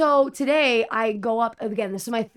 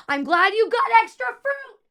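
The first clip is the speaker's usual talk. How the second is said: much higher, slow, sugary fake-sweet jab; sarcastic; 12 semitones higher, 5 dB louder than usual. Server mocking a rude customer over the fruit, sarcastic. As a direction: smile through the insult.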